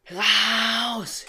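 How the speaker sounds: high, loud shout to get out; screamed order. Acted. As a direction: throw her out with one yell. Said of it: "schrie die Bibliothekarin".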